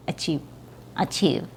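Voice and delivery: achieve, short ee, v nearly b; soft, clipped achieve with blurred final v. Shown as a demonstration. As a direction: cut "achieve" short, muddy the v. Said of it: "or is it a B sound?".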